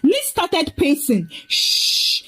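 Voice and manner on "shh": put-on voiceless "shh" to soothe a baby; hushing. A narrator's reading. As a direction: hush the baby. Mother hushing a crying baby.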